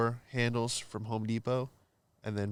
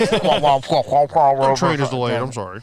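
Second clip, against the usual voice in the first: slow, loud intercom announcement; muffled train announcer; 2 semitones higher, 11 dB louder, slower than usual. Retold or imitated. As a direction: drone it like a subway PA. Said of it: "sounded like the M T A announcer".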